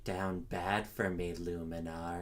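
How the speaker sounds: low, soft seductive voice; sultry purr; sexy voice. Pretend. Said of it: "And that was my sexy voice".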